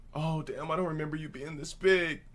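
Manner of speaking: high put-on woman's voice; mock a flattering ex; impressed ex-girlfriend. Voicing an ex complimenting size.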